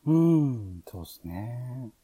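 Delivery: ordinary talk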